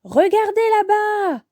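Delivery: high-pitched urgent cry; yelled. A narrator's reading. Yo-Yo alerts friends to something, yelled. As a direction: call out to alert the others. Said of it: "Yo-Yo hurle".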